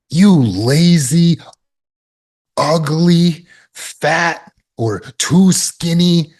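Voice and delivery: harsh self-berating. Acted. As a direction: berate yourself like a scornful drill coach. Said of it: "instead of fear-based self-discipline".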